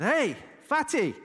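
high, slow cheeky jeer; a drawn-out taunting call; mock taunt. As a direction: tease him into turning on you. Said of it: "So I said".

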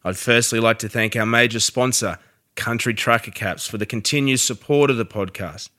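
upbeat sponsor read, loud. Acted. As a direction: read the sponsor spot with punch.

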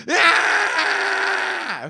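put-on high shrill baby-like scream; mock scream. Imitating a passenger screaming on an airplane, high mock scream. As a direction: let out a sudden wailing "Ah!". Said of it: "just go".

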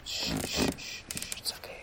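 soft unvoiced shush to a child; whispered shushing. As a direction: hush the child gently. Soothing sleeping child.

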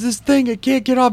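put-on high, panicky kid voice; imitating panicked stepkid. Stepkid stuck with octopus, high panicky kid voice. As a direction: squeak it out like a scared kid. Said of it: "He goes".

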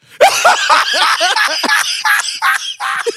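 high shrieking burst of laughter; helpless shriek of laughter at the reveal. Real, not acted. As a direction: lose it completely, shrieking. Shocked by the video.

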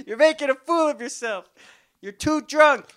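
high, loud mock-mom scolding; shouted warning in a put-on mom voice; yelling mom. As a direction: imitate a mother hollering from off screen. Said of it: "then you hear the mom".

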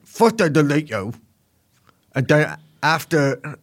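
bizarre English-American accent, drawn out at the end; put-on English-American hybrid accent; Andrew Tate. Shown as a demonstration. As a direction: attempt a muddled half-English, half-American accent. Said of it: "in his bizarre English American accent".

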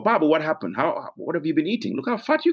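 lightly hammed-up blunt relative; blunt Gambian auntie. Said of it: "people will tell you".

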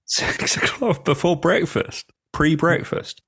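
high-pitched, laughing incredulity; amused disbelief. Real, not acted. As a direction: query it slowly, pitched right up in disbelief. Amused disbelief at early present opening.

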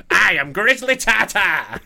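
slow, loud, diva announcement; campy drag queen. Pretend. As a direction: belt it out like a drag queen on stage. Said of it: "Doesn't Grizzly Tata sound like a drag queen?".

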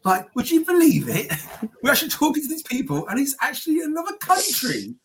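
high-pitched, laughing disbelief as a sitcom uncle; amazed Uncle Bryn. Acted. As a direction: marvel in a high voice, like an astonished uncle. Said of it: "Uncle Bryn, uh, of Gavin Stacey, where he's, like, amazed".

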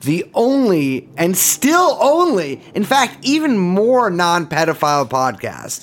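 slow, laughing sign-off; hammy host farewell; mock podcast outro. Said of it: "podcast sign-off".

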